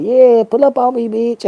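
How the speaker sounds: loud, high imitation of a fake friend; mock bravado. Fake friend inviting someone over, loud mock bravado. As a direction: call it out with put-on swagger.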